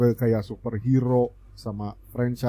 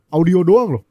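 excited; 7 semitones higher, 6 dB louder than usual. Real, not acted. Hyped about horror teaser.

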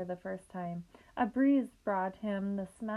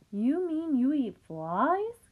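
slow, loud astonished gasp; 5 semitones higher, 6 dB louder, slower than usual. A narrator's reading. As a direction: gasp it out in shock. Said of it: "gasped Wilbur".

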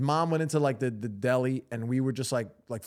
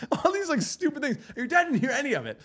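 laughing excitedly; 9 semitones higher, 4 dB louder than usual. Real, not acted. Laughing recalling the prank.

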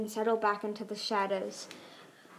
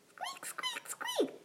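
very high, soft squeaky voice; scavenger squeaks; 7 dB quieter, much slower than usual. Pretend. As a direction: squeak like a tiny pretend critter. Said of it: "he shouted".

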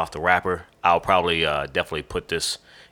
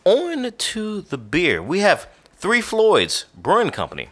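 announcer; 12 semitones higher than usual. Acted. Announcing the next segment.

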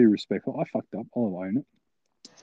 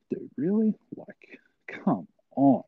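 high incredulous start, then a slow come on; exasperated disbelief; 9 semitones higher, slower than usual. Retold or imitated. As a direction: drag out an exasperated, retold protest. Retelling his protest at being scolded.

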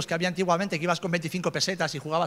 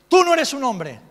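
Tú no eres un hombre bellowed much higher and louder; furious father; 12 semitones higher, 13 dB louder than usual. Retold or imitated. Father shaming his son before other kids, yelling Tú no eres un hombre. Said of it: "como buen macho ibérico".

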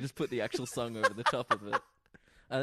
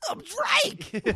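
high, loud goofy voice; hammed-up silly actor voice; 8 dB louder than usual. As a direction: belt out your name like a cartoon. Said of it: "he does a weird voice".

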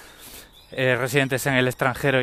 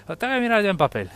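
mocking repeat; 8 semitones higher, faster than usual.

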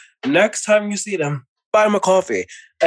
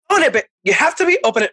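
excited; 10 semitones higher, faster than usual. Real, not acted. Excited reply.

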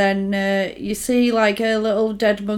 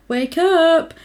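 higher, drawn-out mock call; villain's wake-up call; 7 semitones higher than usual. Co-host voices the villain waking his captives, mock calling out. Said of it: "And he's like".

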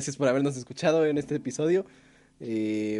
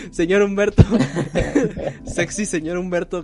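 laughing; 4 semitones higher, 6 dB louder than usual. Real, not acted. Teasing host, laughing.

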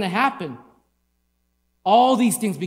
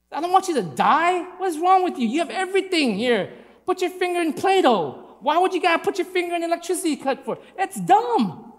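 exasperated parent scolding; 9 semitones higher than usual. Retold or imitated. Preacher voicing himself scolding his kids over the socket, exasperated high scolding.

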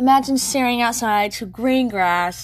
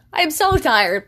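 "so" pitched higher and louder in "I am so tired"; louder emphatic tired; 5 semitones higher, 4 dB louder than usual. Real, not acted. Exhausted vlogger stressing to viewers, emphatic. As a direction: push the complaint out, "so" up.

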